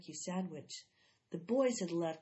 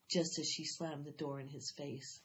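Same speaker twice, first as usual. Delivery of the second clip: ordinary delivery, nothing marked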